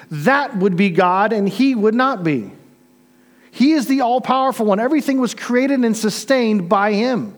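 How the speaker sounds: sermon voice rising in fervor; fervent preaching. Acted. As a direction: lift it like a sermon's climax. Preaching building to emphasis.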